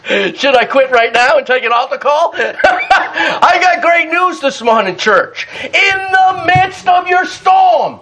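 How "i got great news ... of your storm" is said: preaching shout. Real, not acted. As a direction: build it up and call it out. Preaching at full force.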